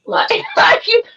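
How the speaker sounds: high squealing laugh. Real, not acted. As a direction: laugh out loud while talking. Laughing at fake apologies.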